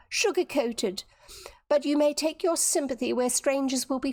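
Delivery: high, indignant tirade; angry. A narrator's reading. Viola accusing Dorothy of prying, angry. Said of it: "the girl's eyes flashed in anger".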